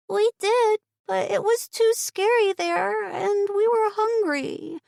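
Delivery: put-on high child's voice, slowing at the end; a small girl explaining, a bit timid; shy little girl. A narrator's reading. Shy niece answering her aunt, high child voice. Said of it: "the girl with the longer hair answered".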